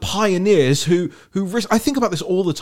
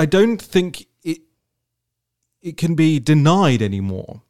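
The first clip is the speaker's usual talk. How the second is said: normal talking voice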